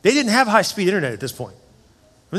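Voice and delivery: usual speaking voice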